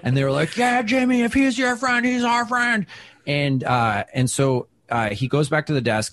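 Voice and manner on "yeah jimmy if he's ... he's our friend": high, far-off crowd chant; voicing a drunk cheering crowd; rowdy crowd.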